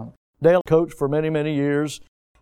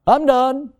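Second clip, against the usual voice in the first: higher, fed up declaration; mock quitter; 9 semitones higher, 4 dB louder than usual. Pastor mimicking a churchgoer quitting over rejection, fed up. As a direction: slam the door on it.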